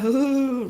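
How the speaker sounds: imitating a roaring lion; mock lion roar. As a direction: puff out a playful lion roar. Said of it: "Which is them roaring".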